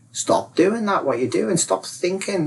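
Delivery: high-pitched, emphatic order to stop; insistent advisor. Acted. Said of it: "My advisor told me".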